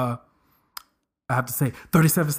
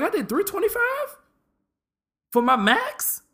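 much higher amazed question; astonished at own lift; mock disbelief at the number; shocked. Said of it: "I was shocked".

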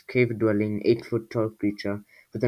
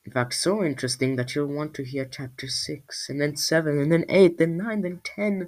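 building excitement; 6 semitones higher than usual. Real, not acted. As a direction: build it up with each number.

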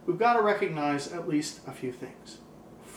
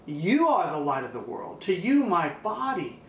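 ordinary talk

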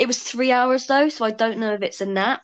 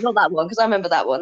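ordinary talk

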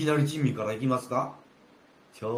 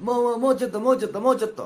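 loud, higher, pressing for more; loud eager begging for a fuller cup; greedy urging; 6 semitones higher, 8 dB louder, faster than usual. Acted. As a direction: hurry the pourer along greedily. Take urging more pouring.

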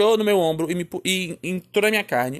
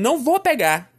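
mock defiant, raised-pitch refusal; 6 semitones higher than usual. Child refusing mother a second time, acted defiance. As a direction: act out a stubborn child's higher-pitched no.

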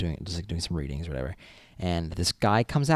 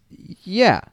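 guard's flat yes; 11 semitones higher, 7 dB louder than usual. Retold or imitated. Guard voiced in story.

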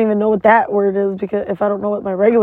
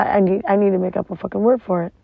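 ordinary talk

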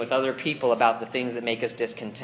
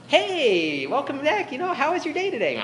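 much higher, bright sing-song greeting; cheery parent; 13 semitones higher than usual. Pretend. Preacher voicing a parent cheerfully asking about the day, high sing-song. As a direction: greet them like an overly cheerful mom.

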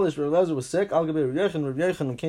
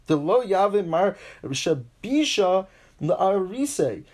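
usual speaking voice

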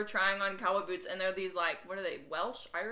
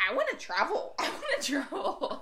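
excited; 7 semitones higher, 4 dB louder than usual. Retold or imitated. Excited quote about travel plans.

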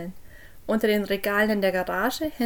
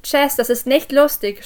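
higher, much louder scolding; angry shout; 4 semitones higher, 8 dB louder than usual. A narrator's reading. Parent yelling at hidden Jess in the cellar, angry shout. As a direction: shout it to stop the game. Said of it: "schrie ich".

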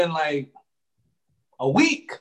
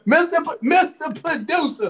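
higher, excited call-out; 6 semitones higher than usual. Real, not acted. Calling out the producer again, higher.

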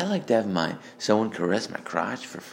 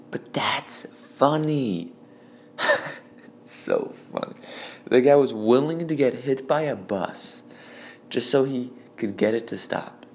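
normal talking voice

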